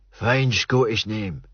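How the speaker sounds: put-on slow, approving Scots old man; old Joe approving the name, slow Scots; Scottish old man.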